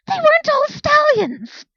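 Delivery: very high, loud, slow shriek; outraged shriek. Acted. Incensed Speck correcting Claire, loud shriek. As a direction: scream it in indignation.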